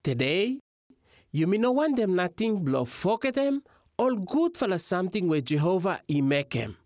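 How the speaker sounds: normal talking voice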